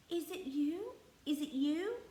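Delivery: bursting with delight; thrilled. A narrator's reading. Mary calling to the robin, thrilled.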